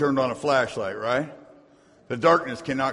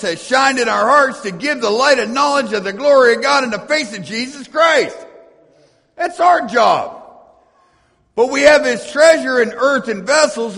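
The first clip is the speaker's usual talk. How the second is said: loud, high, cadenced preaching of the verses; preaching scripture; 6 semitones higher, 7 dB louder than usual. Acted. Preacher proclaiming Paul's letter to his congregation, full force. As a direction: proclaim it to the whole church.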